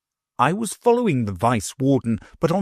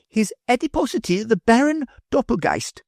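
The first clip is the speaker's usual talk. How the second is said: higher, quick formal announcement; professor announcing; 13 semitones higher than usual. A narrator's reading. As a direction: read the name off the card. Professor reads a card.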